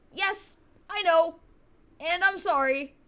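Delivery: high, loud reply to a scolding; loud acted apology. Acted student answering scolding teacher.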